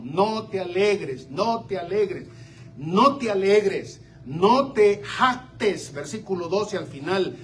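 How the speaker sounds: usual speaking voice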